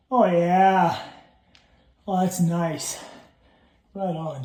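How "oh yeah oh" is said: normal talking voice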